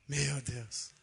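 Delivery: wife waking and looking at her husband, softly dismayed. Retold or imitated. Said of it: "olha pra mim e fala assim".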